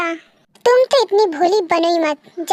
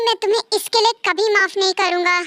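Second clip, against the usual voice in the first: ordinary talk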